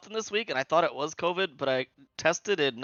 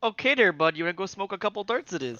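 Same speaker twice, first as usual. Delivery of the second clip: higher-pitched, hammed-up Canadian buddy talk; Canadian accent; 4 semitones higher than usual. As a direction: play a chummy cartoon Canadian. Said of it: "doing a crazy Canadian accent".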